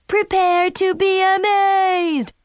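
high, slow, loud showman bellow. A narrator's reading. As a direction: bellow it to the whole audience.

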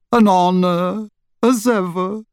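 high, slow, fawning bow voice; a little wizard bowing deeply; fussy little wizard. A narrator's reading. Daedalus bowing.

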